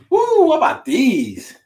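high, slow excited woo. Real, not acted. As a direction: hype up the shoes. Shows off shoes excitedly.

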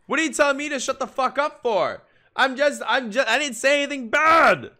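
high, loud, offended yelling; indignant protest. Real, not acted. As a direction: shout back at the screen as if wrongly accused. Protesting at the anime character.

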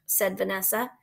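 ordinary delivery

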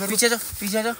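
urgent frightened calls. Real, not acted. Urging friend back from leopard.

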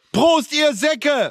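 high, slow rowdy toast; rowdy party animal. Real, not acted. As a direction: bellow the toast. Drunken toast.

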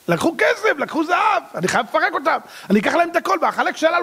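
high-pitched, agitated rant; rally the troops in a high, heated voice; worked-up ranting. Acted. Pharaoh rousing his army to recover the loot, worked up.

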